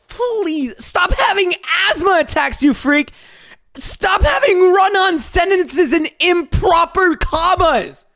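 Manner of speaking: high, loud exasperated shrieking; exasperated yelling. Real, not acted. Reader begging a fanfic writer to write properly, exasperated yelling. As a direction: yell it like the bad writing is driving you mad.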